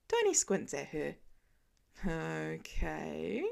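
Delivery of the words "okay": a slow, wary okay; drawn out, unsure what she means; said slowly. A narrator's reading. Tony, suspicious of Pepper's sudden wish, slowly. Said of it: "he says slowly".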